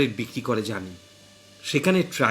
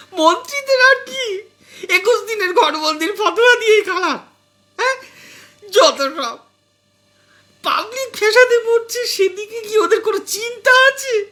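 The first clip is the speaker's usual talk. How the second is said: much higher, louder wailing with sobs; tearful shrieking; 7 dB louder than usual. Acted. Wife wails at husband about the lockdown, sobbing and shrieking. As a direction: wail and shriek through tears. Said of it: "কাঁদো কাঁদো গলায় চিল চিৎকার শুরু করে দিয়ে বলল".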